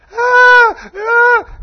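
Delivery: high, loud anguished scream; mother's scream. Retold or imitated. Preacher acting out the mother's scream in the hospital. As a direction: scream like a mother who just heard her son was shot. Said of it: "a girl come in screaming".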